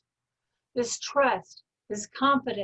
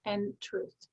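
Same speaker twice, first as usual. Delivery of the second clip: slow, deliberate affirmation with long pauses; a calm, measured self-affirmation. Shown as a demonstration. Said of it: "So your command is".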